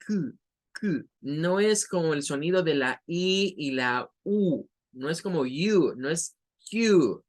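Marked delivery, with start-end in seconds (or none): 0.0-1.1 Q said like the letter Q, a yoo sound; syllable Q. Shown as a demonstration. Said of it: "pues va a sonar a K". 6.0-7.2 Q slow, said like the letter name; wrong Q. Shown as a demonstration. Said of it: "es con un schwa".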